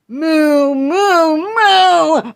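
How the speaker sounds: rising loud moos; loud cartoon moo; mock mooing. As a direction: moo like a cow, climbing. Mooing like a cow.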